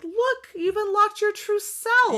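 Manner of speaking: high, slow, sugary villain voice; lightly hammed sorceress line; mock enchantress voice. Retelling Circe's line, mocking.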